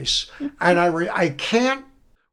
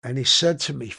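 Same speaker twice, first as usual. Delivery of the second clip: ordinary talk, nothing marked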